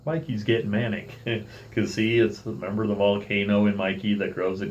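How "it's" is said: ordinary delivery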